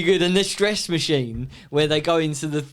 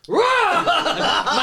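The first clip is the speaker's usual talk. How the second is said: much higher laughing mock shout; mock roar; 14 semitones higher, 3 dB louder than usual. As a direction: jump out with a roar. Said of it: "Roy comes out of nowhere and goes".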